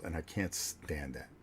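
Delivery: ordinary talk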